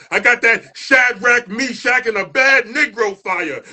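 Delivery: building, rhythmic spoken-word delivery; spoken word performance. Acted. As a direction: perform it like a poem slam, rising toward the shouts.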